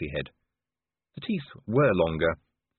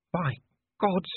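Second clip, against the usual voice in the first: ordinary delivery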